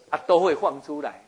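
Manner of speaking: ordinary delivery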